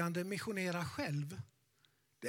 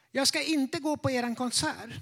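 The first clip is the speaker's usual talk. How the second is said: higher, louder lightly voiced old lady; a nervous elderly woman, pitched up; 5 semitones higher, 4 dB louder than usual. Old lady at the church door, nervously pitched up. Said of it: "Hon var lite nervös".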